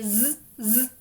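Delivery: "z, z" buzzed out; z sound. Shown as a demonstration. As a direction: repeat the z buzz. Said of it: "my S is going to say".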